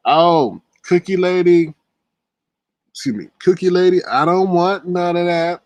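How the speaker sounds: a touch of mock scared pleading. A mock scared man begging Cookie Lady. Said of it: "acting all scared".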